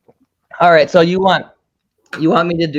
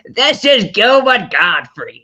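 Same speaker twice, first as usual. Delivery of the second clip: slow, squinting nasal screech; raspy comic shriek; Gilbert Gottfried voice; 4 semitones higher, slower than usual. Retold or imitated. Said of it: "Gilbert Gottfried, I always like doing that one".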